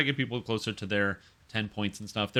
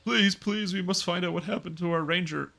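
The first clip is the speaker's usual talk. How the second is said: higher pleading voice, lightly voiced wife; 7 semitones higher than usual. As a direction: beg the crowd for help as the mayor's wife. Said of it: "I don't have a good accent, but I'm trying my best".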